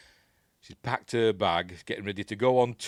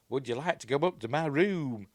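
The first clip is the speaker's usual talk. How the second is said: higher, lilting mock come-on; mock seductive; 6 semitones higher, 3 dB louder than usual. Woman inviting the projectionist to her room, mock seductive. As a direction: act out a playful invitation upstairs. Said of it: "tries to seduce him up there".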